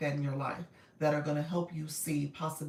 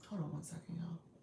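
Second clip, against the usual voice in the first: much softer, distracted aside; hushed aside; 12 dB quieter than usual. Real, not acted. Host pausing her live to check something, hushed aside. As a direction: drop the voice right down, briefly stepping away.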